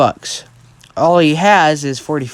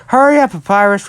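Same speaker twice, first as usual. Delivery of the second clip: raised, higher call to hurry someone; urgent call; 6 semitones higher, 5 dB louder than usual. Retold or imitated. Brother hurrying brother out the door, urgent call. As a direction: call out to get them moving.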